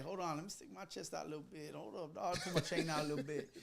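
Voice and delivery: hushed aside to oneself; retold self-talk. Teller readying himself before meeting the big DJ, retold self-talk. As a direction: drop the voice well down, privately puffing yourself up.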